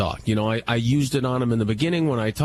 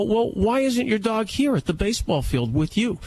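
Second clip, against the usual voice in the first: challenging question; 9 semitones higher than usual. Retold or imitated. Retold argument with a stranger.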